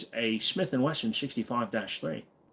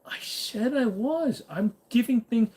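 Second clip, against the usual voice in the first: mock high voice; 12 semitones higher, slower than usual. Mocking high voice opening.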